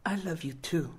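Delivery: put-on a sick boy too weak to speak up; weak soft voice. A narrator's reading. Rooster greets Ray from his hospital bed, soft and weak, just above a whisper.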